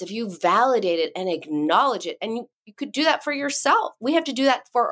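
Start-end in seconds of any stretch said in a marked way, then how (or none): none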